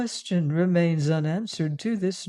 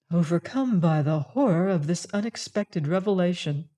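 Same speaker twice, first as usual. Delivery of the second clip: plain reading voice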